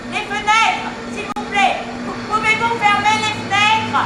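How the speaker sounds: forte demande, haute et pressante; crier par-dessus le bruit. Retold or imitated. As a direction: crie pour couvrir le vacarme. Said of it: "Je crie". (crie au chauffeur de fermer les fenêtres)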